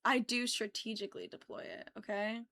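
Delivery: whispered admission, playfully guilty. Real, not acted. Whispering back to her co-host, owning it with a grin. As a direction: breathe it out like a confession. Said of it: "she deploys that".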